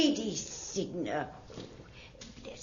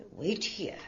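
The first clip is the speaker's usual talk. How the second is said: usual speaking voice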